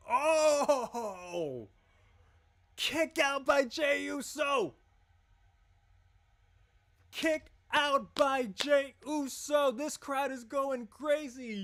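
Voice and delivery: high, slow, loud hype yelling; excited shout. Real, not acted. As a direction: scream the near-fall call. Live ringside call, big kickout.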